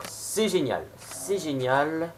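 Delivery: drawn-out "c'est génial", very slow; lingering, savouring praise; slow emphatic. Real, not acted. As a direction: stretch it out with satisfaction. Sums up the haul.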